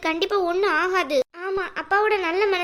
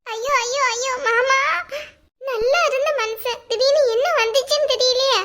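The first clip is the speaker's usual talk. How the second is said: high wailing cry of grief; wailing lament; 6 semitones higher than usual. Acted. Mother wails at hospital. (உயர்ந்த குரலில் ஒப்பாரி அழுகை)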